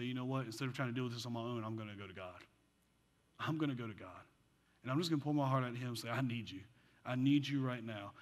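soft and low, turning to God; low, subdued plea, imitating someone praying; quiet retold prayer. Voices a woman's surrender in prayer.

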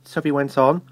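plain reading voice

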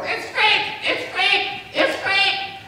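high, loud squawking parrot voice, far from his own. Acted. Ornery parrot heckles the magician's show, high loud squawk. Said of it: "this parrot would go".

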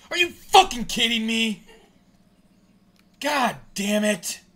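full-force high yell, real anger; furious shout. Real, not acted. Fan watching the lead get blown, furious shout. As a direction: explode loudly, then drag out the curse. Fan explodes at game result.

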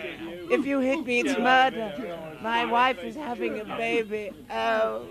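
high, whimpering, begging for mercy; high pleading panic. Acted. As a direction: plead like a terrified fop. Toff begs not to be hit.

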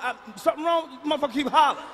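ordinary delivery

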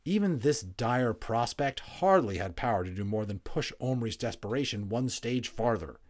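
ordinary delivery

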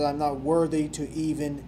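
John the Baptist confessing, barely audible; humble confession. Acted. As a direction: confess it almost in a whisper, humbled. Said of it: "John the Baptist said".

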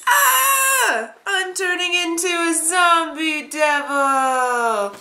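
high, slow mock wail; hammed-up transformation cry, stretched out; Sanan. Reviewer acting Sanan changing after touching blood, high theatrical wail. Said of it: "he's super creepy and he's like".

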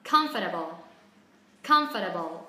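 American "Comfortable" with the t softened to a d; American accent, COM stressed and t as d; American pronunciation. Shown as a demonstration. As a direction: say "Comfortable" American-style, "KUMF-der-bol", falling low. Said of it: "phát âm theo kiểu người Mỹ".

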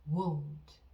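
won't said slowly as one clear syllable, wohnt; careful pronunciation model of won't; won't pronounced. Shown as a demonstration. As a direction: model the sound of won't, long o, crisp t. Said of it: "요거 발음".

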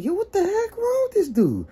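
very high-pitched freaked-out reaction; alarmed disbelief. Retold or imitated. Reacting to the red-eyed DJ, freaked out. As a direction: squeal it in disbelief.

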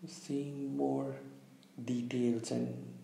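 calm meditation guide, long pauses between phrases; unhurried, slightly hushed meditation delivery; guided visualization. Real, not acted. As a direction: lead listeners gently inward, leaving space after each instruction. Leads a guided visualization exercise.

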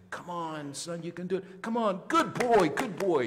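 put-on high, swooping baby-talk cheer; acting out a proud father egging on his infant; encouraging dad. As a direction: coax a crawling baby, building to high, loud praise. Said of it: "And you say".

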